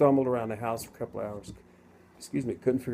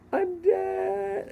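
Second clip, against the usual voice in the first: sudden high, loud cry of realization; the instant you realize you're dead; shocked realization; 5 dB louder than usual. Retold or imitated. Realizing he has died.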